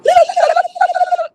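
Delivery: piercing high shrieking laugh; screaming cackle. Retold or imitated. Prank-calling friend on Thanksgiving morning, shrieking laugh. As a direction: cackle into the phone to wake her. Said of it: "I would just go".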